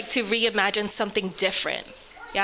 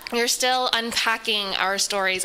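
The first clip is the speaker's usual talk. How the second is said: ordinary talk, nothing marked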